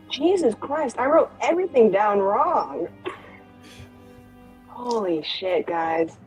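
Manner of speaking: ordinary delivery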